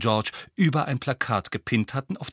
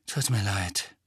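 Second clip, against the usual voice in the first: a touch subdued and quieter; dejected apology; 2 semitones lower, 3 dB quieter, slower than usual. Acted. Harry apologising to Hermine, mildly dejected. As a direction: say sorry, a little crushed. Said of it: "sagte Harry mit bedrückter Stimme".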